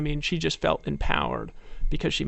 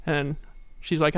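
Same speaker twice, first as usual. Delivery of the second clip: usual speaking voice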